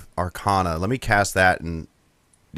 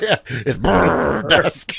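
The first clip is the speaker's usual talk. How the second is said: slow and laughing high; 8 semitones higher, much slower than usual. Real, not acted. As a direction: giggle through it. Joking about burr dusk.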